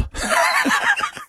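sudden high-pitched laugh; cracking up at his own joke; high burst of laughter. Real, not acted. Host laughs at own bit.

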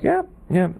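high mock yeah yeah; imitating the lady. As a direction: toss off a squeaky dismissive yeah. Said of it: "she's like".

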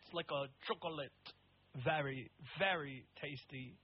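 badly faked French accent; attempted French accent. Co-host jokes about France in accent, bad French accent. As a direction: keep up a clumsy French accent. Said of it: "Like France".

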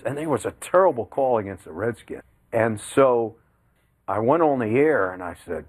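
ordinary delivery

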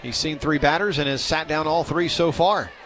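ordinary talk

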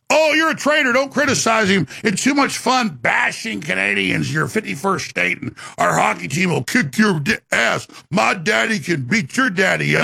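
high mock-outraged imitation, easing down; mocking taunt. Host imitating critics who bash Canadians, mocking taunt.

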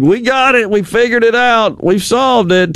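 high-pitched mock boast, easing down; mock triumphant announcement. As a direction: imitate officials proudly claiming victory. Officials' big announcement quoted mockingly.

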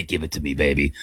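low, hushed creepy croon. Shown as a demonstration. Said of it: "I also get that creepy voice where I say".

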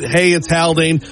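ordinary talk, nothing marked

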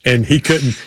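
normal talking voice

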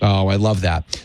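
slow, low and drawn out, savoring "Oh"; drawn-out low approval. Real, not acted. Radio host to caller resting up, slow low approving. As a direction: stretch the "Oh, I love that" low and slow.

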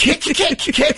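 mock dog kick-kick. Imitating dog kicking grass.

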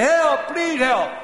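very high, loud yelps; frantic comic cries for help; hollering for help. Acted. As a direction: holler for rescue while running past. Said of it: "He hollered".

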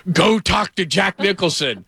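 high, drawn-out mock producer order; urgent producer. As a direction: imitate a producer urging through an earpiece. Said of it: "my producer goes".

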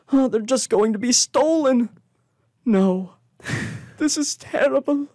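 high, anxious little shopkeeper wailing over stolen hats; fretful kobold. Acted. Kobold shopkeeper begging the party for help, high anxious whine. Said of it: "he looks over to Amaretta and goes".

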